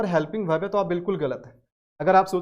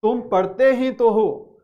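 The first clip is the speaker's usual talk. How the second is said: पढ़ते pushed louder and slowly; emphatic Hindi stress; 3 semitones higher, 5 dB louder, slower than usual. Shown as a demonstration. Teacher showing emphasis to students. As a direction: stress पढ़ते ही तो, slow and firm. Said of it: "जोर डालने के लिए".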